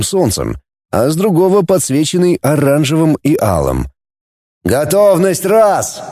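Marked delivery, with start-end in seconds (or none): 4.6-6.0 ringing command. Acted. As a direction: call it across the deck. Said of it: "разнеслось по палубу". (громкая команда)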